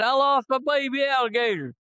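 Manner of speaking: high, anxious voice of a frantic sergeant; mock panic over lost gators; upset sergeant. Sergeant frets over his lost baby alligator, high and anxious.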